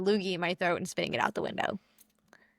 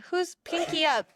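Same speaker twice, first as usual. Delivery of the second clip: high, prim upper-class voice; pinky up, posh and prissy; 7 semitones higher than usual. Retold or imitated. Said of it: "Who's posh and says".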